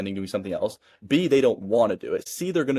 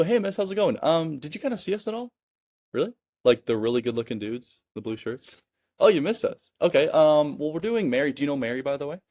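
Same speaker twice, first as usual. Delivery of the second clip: higher, breezy friendly neighbor; casual and playful; 4 semitones higher than usual. Shown as a demonstration. Demoing the right way to a homeowner, relaxed and playful. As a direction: toss it off like a normal guy popping by. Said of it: "I'm just some normal dude popping by".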